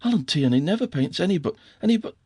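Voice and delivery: breathless, rising in disbelief; tripping over the words; flustered stammer. A narrator's reading. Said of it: "stammered Valancy".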